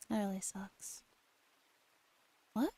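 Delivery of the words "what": normal talking voice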